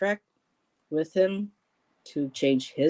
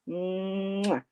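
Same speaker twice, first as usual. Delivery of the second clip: blown kiss; 3 semitones higher, 3 dB quieter than usual. Real, not acted. Host blows a kiss for the viewer's cat, smacking kiss. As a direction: blow a kiss into the mic.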